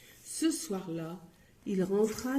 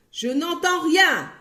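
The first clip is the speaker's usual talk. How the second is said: much higher, loud roar; 8 semitones higher, 8 dB louder than usual. Acted. Butcher roaring he hears nothing. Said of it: "rugit le boucher".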